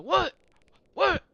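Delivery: very high, loud squawks of disbelief; mock an outraged double-take, voice leaping up; imitated freak-out. Sergeant major freaking out at junior soldier, high-pitched disbelief. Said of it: "Just, like, freaking out".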